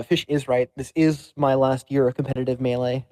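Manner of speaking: ordinary delivery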